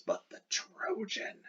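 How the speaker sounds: hushed whisper of Dido's scorn. Acted. Dido whispering bitterly about her lover. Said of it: "She says".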